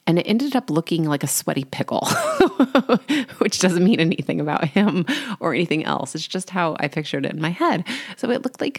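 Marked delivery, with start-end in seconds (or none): 2.1-3.3 a quick, amused burst of laughter; a short laugh, a bit higher and louder. Real, not acted. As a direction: laugh at your own silly picture. Laughing at her sweaty pickle drawing.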